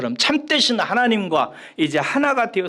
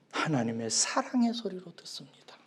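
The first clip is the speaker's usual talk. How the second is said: whisper; 9 dB quieter than usual. Acted. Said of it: "whispered sermon point".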